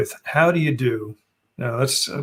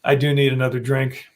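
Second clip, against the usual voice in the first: usual speaking voice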